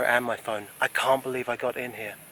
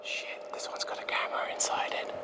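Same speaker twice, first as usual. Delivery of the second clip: whispering; 2 semitones higher, 8 dB quieter than usual. Real, not acted. Sneaking past a camera, whispering.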